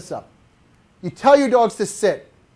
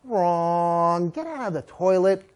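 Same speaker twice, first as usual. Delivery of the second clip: lower, stretched "Wrong" reproof; drawn-out guttural; 4 semitones lower, 5 dB quieter, slower than usual. Shown as a demonstration. Trainer shooing dog from toilet, drawn-out guttural. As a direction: growl it long, deep in the throat. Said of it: "Three guttural ways".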